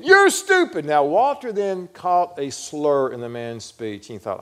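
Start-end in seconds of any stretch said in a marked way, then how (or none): 0.0-0.8 high-pitched, loud outburst; heckler's shout. Retold or imitated. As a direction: heckle the speaker at full voice. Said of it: "the guy stood up again".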